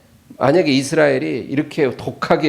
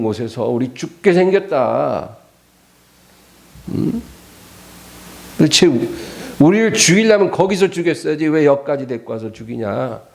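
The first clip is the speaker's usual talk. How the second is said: sighing, aggrieved protest in a raised pitch; mock resentful outburst; acted bitter attack; 3 semitones higher than usual. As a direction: play the grumbling crowd turning on its leaders. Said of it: "독한 말로 공격을 하기 시작해요".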